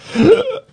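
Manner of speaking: sharp gasp. Real, not acted. Host startled on the line.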